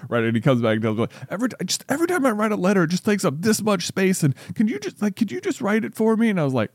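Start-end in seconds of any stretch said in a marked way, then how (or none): 1.3-6.3 higher-pitched imitation of a small kid asking; higher, lightly voiced little kid; child's request. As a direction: voice a kid's pleading ask, pitched up. Voicing his child's request to dad.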